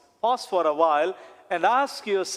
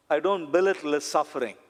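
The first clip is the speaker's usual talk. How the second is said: ordinary delivery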